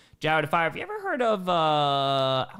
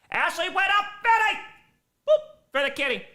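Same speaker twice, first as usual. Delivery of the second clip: high, loud call after the pets; 14 semitones higher, 4 dB louder than usual. Real, not acted. Owner calling after pets running off, loud and high. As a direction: shout after them to wait.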